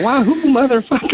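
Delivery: high hammed-up "Wahoo, motherfucker"; mock gangster whoop. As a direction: imitate a wild mobster cheering. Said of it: "You didn't go".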